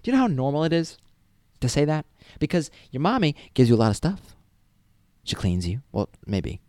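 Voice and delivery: ordinary delivery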